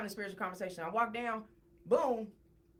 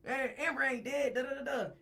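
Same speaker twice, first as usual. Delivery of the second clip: higher-pitched imitation of jeering podmates; mocking crowd; 5 semitones higher than usual. Everybody on the pod dismisses Sarah, high mocking imitation. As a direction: rattle it off high, imitating people who scoff. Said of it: "Everybody was laughing".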